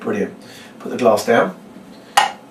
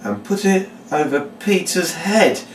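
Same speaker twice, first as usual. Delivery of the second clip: slow, high, theatrical read-out; deliberate showman's instruction; reading the words; 6 semitones higher, slower than usual. Shown as a demonstration. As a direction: announce the step with drawn-out suspense. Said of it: "just reading the words".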